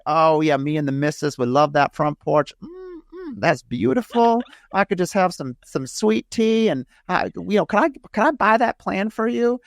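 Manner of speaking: high-pitched mock Southern drawl; Southern accent. Caller phoning the builder about the front porch plan, hammed-up Southern accent. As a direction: imitate a Southern caller, pitched well up and drawn out. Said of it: "I'd impersonate my Southern accent".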